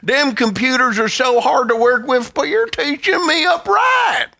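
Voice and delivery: folksy Southern fan calling out; Southern accent. Pretend. Imagined Southern fan praising the host, Southern accent. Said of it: "now in a Southern accent".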